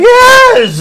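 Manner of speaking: high loud ecstatic yes; screaming yes. Acted. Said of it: "Start screaming like".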